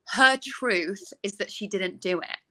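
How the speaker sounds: ordinary talk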